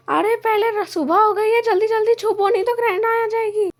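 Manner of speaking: high, urgent character voice; panicked warning. Acted. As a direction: warn everyone to hide right now. Urgent warning to hide at daybreak. (घबराई ऊँची आवाज़ में चेतावनी)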